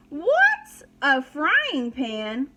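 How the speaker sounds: high, loud startled cry on What; shocked yelp, then a slow frying pan; surprised cry. Acted. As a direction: blurt it out in disbelief. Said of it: "cried Herschel".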